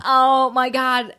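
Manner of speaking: slow drawn-out excited oh my God. Real, not acted. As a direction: stretch it out in delight.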